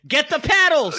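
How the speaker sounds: loud, high mock medical command; mock emergency yell. Joking about the resurrection scene, mock emergency yell. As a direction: call it out like a TV medic.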